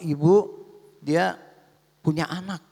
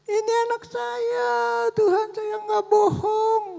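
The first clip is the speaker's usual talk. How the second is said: ratapan tinggi yang makin keras dan diulur; menangis membela diri; 6 dB louder than usual. Acted. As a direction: bersumpah sambil menangis, nada sangat tinggi. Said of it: "Dia nangis saja". (ibu menangis meyakinkan Tuhan)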